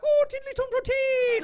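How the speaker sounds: high, loud, sing-song jolly Irish voice; jolly fun Irish. Retold or imitated. Comic doing a mocking jolly Irish voice to the crowd. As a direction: trill it like a carefree merry Irish type. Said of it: "You've got the fun Irish, who's like".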